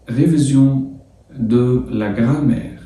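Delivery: French title drawn out slowly for students; slow sounding-out. Shown as a demonstration. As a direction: sound out "ريفيزيون ... دو لا جرامير" slowly, phrase by phrase. Teacher sounds out French lesson title. (نطق العنوان الفرنسي ببطء، جزء جزء)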